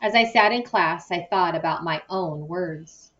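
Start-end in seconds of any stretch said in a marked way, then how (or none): none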